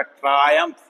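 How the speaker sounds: ट्रायम्फ high, "trai" then "uh"; high, careful model of ट्रायम्फ; diphthong plus schwa. Shown as a demonstration. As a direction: raise the pitch and sound out both vowels in ट्रायम्फ. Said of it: "फिर श्वा sound है".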